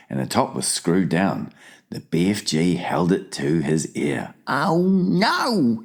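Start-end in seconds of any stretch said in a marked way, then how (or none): 4.5-5.8 high giant's cry of dismay. A narrator's reading. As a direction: cry out in alarm. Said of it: "he cried".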